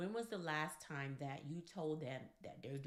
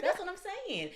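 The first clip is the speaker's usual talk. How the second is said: excited; 12 semitones higher, 8 dB louder, faster than usual. Real, not acted. Excited agreement to laughing partner.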